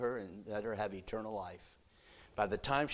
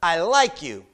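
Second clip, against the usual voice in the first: 1 Peter; 9 semitones higher, 15 dB louder, slower than usual. Acted. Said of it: "Peter says".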